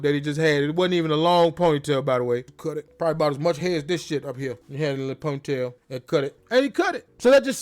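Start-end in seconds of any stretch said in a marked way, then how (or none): none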